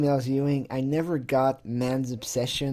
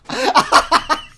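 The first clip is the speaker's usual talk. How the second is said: high laugh; 10 dB louder than usual. Real, not acted. Laughing at the name.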